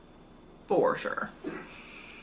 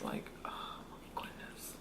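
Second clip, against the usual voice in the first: slow, soft unvoiced whisper; 17 dB quieter, slower than usual. Real, not acted. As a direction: breathe it out, overwhelmed. Full, whispered groan of amazement.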